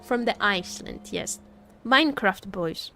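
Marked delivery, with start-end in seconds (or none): none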